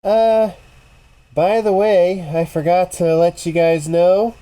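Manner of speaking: high, loud tiny voice calling over; little tiny voice. Acted. Game master as someone calling from the other side, little tiny voice. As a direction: pitch it way up and project like a tiny far-side creature. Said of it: "you hear this little tiny voice".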